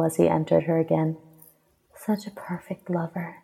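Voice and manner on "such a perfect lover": very soft cooing praise. A narrator's reading. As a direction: coo it softly. Continues her purring praise.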